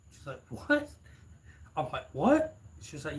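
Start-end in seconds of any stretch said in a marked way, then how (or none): 0.5-0.9 high-pitched rising "what?"; a jump into high, questioning disbelief; retold surprise. Retold shocked reaction in dialogue. 2.1-2.6 loud, high rising "what?"; high, incredulous echo; retold surprise. Retells own startled reply.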